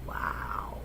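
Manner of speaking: a breathless whispered wow, taken aback; stunned wow. Real, not acted. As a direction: let the wow fall out as a whisper of disbelief. Reacts to the interview clip.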